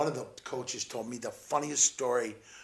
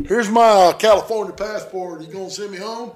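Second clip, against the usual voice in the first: mock border crossing; 6 semitones higher, 12 dB louder than usual.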